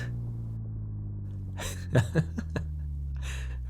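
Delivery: long, low, soft laugh; teasing chuckle. Acted. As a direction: laugh low at your own joke. Teasing detective about bloodstained shoes.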